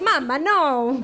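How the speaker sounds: put-on drawn-out toddler Italian accent. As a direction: imitate a little Italian man, slowly. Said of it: "speaks in an Italian accent".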